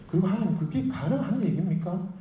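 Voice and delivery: high-pitched acted disbelief; incredulous objection. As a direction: question God as if it were absurd. Moses doubting God, acted. (말도 안 된다는 듯 높여 따지며)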